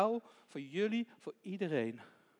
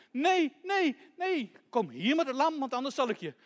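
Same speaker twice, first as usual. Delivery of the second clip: loud, raised-voice demand; angry threat; 7 semitones higher, 6 dB louder than usual. Acted. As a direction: threaten the child, insisting loudly. Thief demands lamb with threat.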